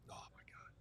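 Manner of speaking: breathless hushed whisper. Real, not acted. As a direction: whisper it in disbelief. Stunned reaction to replay.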